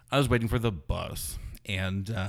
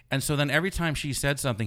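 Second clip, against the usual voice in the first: usual speaking voice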